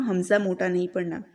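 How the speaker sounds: ordinary delivery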